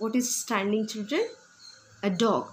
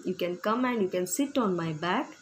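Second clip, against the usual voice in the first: ordinary talk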